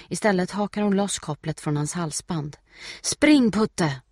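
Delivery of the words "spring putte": high shout falling on the name; urgent call. A narrator's reading. Steffi to her dog Putte, urgent call. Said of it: "ropar hon".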